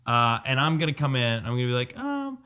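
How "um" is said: put-on seventeen-year-old girl. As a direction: put on a timid teenage girl, faltering. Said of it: "a seventeen-year-old girl".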